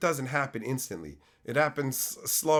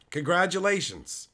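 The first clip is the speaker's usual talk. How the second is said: ordinary talk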